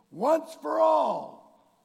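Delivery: Once for all, slow and shouted high; shouted exhortation. Acted. Preacher pleading with the brother, slow shout. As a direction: cry out Once for all, stretched long.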